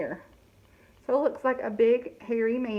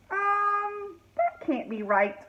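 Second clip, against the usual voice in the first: puzzled fairy; 9 semitones higher, slower than usual. Acted. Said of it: "said the fairy".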